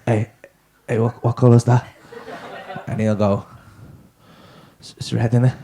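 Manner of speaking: low dazed stoner voice; stoned mate. Retold or imitated. Stoned student asking his mate about a colour, low and dazed.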